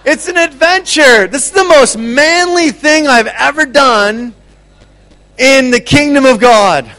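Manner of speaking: loud, high, exultant proclamation; rally the crowd with a joyful roar; excited shout. Real, not acted. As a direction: shout it like a grand adventure.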